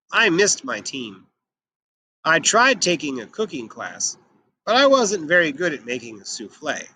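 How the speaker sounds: lively, expressive read-aloud as Santa; storybook narration voice for young listeners; reading to children. Acted. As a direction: tell the story to a class, bringing Santa to life. Teacher reads Santa picture book aloud.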